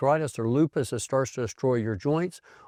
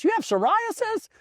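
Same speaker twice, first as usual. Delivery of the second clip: incredulous patient. Retold or imitated. Patients reacting to his psoriasis.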